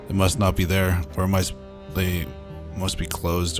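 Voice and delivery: ordinary delivery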